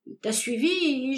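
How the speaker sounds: ordinary delivery, nothing marked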